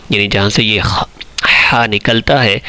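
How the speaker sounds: ordinary talk, nothing marked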